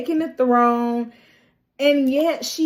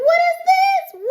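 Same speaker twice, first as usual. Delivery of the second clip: far higher, louder squeal of disgust; mock shocked nobles; 5 dB louder than usual. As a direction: shriek it like a fussy noble. Nobles shocked at rat dinner.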